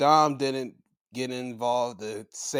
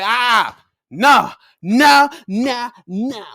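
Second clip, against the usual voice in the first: mock refusal; 8 semitones higher, 11 dB louder, much slower than usual. Voicing wrestler's mock refusal.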